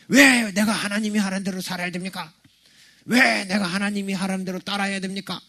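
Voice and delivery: acted-out defiant complaint, high-pitched; indignant resistance. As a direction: push back hard, imitating someone who refuses to obey. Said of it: "이렇게 거부감을 일으켜요".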